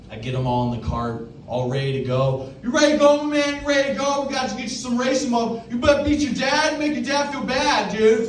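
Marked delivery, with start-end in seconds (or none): 2.6-8.3 amped go-kart hype guy. Retold or imitated. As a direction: pump the kid up like a hype man. Hyping the kid up before racing.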